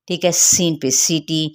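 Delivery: ordinary talk, nothing marked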